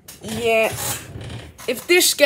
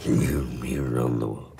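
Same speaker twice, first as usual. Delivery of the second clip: mock deep voice; 9 dB quieter than usual. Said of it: "that was Dog Day sad song".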